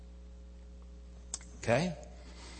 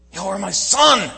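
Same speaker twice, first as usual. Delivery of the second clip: loud, high proclamation from heaven; booming voice of God; 8 semitones higher, 16 dB louder than usual. Acted. Preacher booming God's voice to the congregation. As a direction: thunder it out as God.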